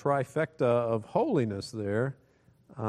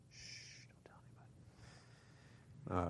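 mock whisper; 6 semitones lower, 18 dB quieter than usual. Mock secret after joke about election.

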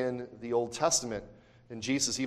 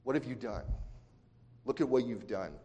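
hushed, softer accusing voice; insinuating whisper; 2 semitones lower, 9 dB quieter than usual. Acted. Satan whispering doubt into your heart, hushed. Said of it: "What is Satan whispering".

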